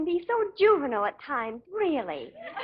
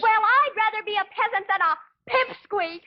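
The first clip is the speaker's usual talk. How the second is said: heated squabble; 7 semitones higher than usual. Acted. Young couple trade petty insults in a row, heated.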